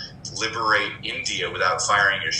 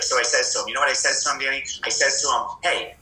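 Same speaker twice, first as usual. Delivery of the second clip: imitating New York mom; 5 semitones higher, faster than usual. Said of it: "she'll tell me a story".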